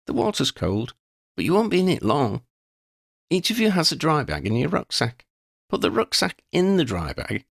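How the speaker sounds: a capable leader giving orders; a hint of a firm instructor; [name]'s voice. [name] briefs the group.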